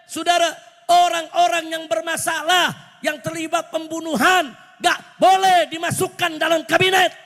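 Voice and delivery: high, forceful warning to the crowd; fiery preaching. Real, not acted. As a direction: lay down the law from the pulpit. (khotbah lantang penuh tekanan)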